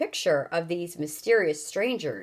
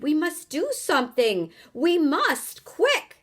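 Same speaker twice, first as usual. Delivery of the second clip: urgent plea; 8 semitones higher than usual. Acted. Charlie urgently pleading.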